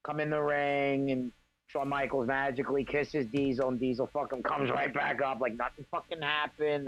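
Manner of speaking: normal talking voice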